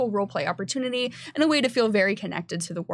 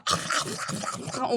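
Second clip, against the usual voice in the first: mucusy voice; 6 dB quieter than usual. Shown as a demonstration. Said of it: "he kind of has, like, a".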